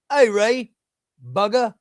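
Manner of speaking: high, loud mock Cockney; cheeky put-on British voice. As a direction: call out in a hammed-up English accent. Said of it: "maybe he needs to be less classy".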